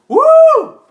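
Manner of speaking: sudden high loud woo; mock crazy whoop. Vlogger mimicking going wild when the camera turns on, high whoop. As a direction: burst into a silly cheer for the camera. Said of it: "It's just go crazy up in here".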